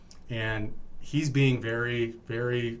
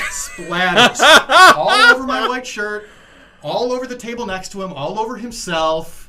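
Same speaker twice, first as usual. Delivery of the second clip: building excited shout; 9 semitones higher, 13 dB louder than usual. Real, not acted. Telling a friend how the sauce splattered, rising to an excited shout. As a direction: voice climbs high and loud as the mess spreads.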